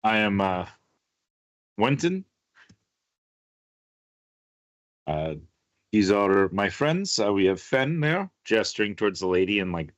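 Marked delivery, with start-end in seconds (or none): 0.0-8.3 Wenton. Acted. Wenton introduces his crew.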